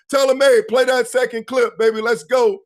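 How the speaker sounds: loud cue to roll the clip; hype call. Real, not acted. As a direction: fire up the room. Calling producer to play clip.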